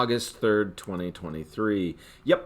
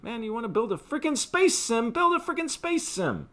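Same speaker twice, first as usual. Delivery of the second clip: higher, louder frustrated rant; getting angry; 5 dB louder than usual. Real, not acted. Ranting at Bethesda about the space sim, getting angry. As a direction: vent your real frustration at the game. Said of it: "Sorry, I'm getting angry".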